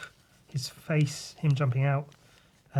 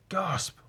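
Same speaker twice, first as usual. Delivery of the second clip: startled gasp; 5 semitones higher, 4 dB quieter than usual. Acted. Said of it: "And then suddenly".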